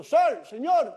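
loud, high shout up to a rooftop; urgent yell to someone far above; calling out. Retold or imitated. Passerby warning a man on the rooftop of fire, shouting. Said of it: "Entonces le empiezo a gritar".

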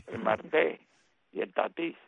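Marte and Tatís both punched on the last syllable; mocked final stress. Commentator challenging a colleague's Americanized names, Marte and Tatís stressed on their last syllables. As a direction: exaggerate the end stress, "mar-TÉ", "ta-TÍS". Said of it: "Eso de palabra aguda".

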